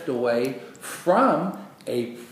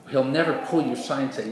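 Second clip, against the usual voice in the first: normal talking voice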